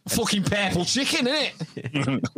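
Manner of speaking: high, exclaiming Scouse; thick Scouse accent. Acted. Answering about Liverpool's emblem, thick Scouse accent. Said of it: "how thick can a Scouse accent get".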